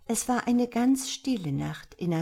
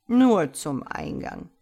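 lecturing; 3 semitones lower, 6 dB louder than usual. A narrator's reading. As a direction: set the child straight, louder and measured. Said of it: "belehrte der Kater".

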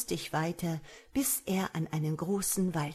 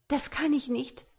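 high-pitched, anxious woman's voice; young woman, refusing; 8 semitones higher than usual. A narrator's reading. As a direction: refuse him in a high, worried voice. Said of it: "antwortete sie". (hohe Frauenstimme, besorgt ablehnend)

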